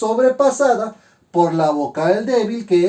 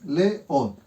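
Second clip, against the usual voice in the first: "Le, o" as two separate beats; teacher breaking "Le, o" apart; split into syllables; 3 semitones lower, 4 dB quieter than usual. Shown as a demonstration. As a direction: clear pause between "Le" and "o". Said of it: "Fuerte y fuerte se separan".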